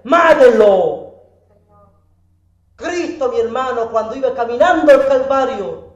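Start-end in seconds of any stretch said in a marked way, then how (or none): 0.0-1.1 loud, drawn-out mob yell; crowd shouting. Retold or imitated. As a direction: shout like a crowd demanding death. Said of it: "después estaban gritando". (grito de turba, fuerte y alargado)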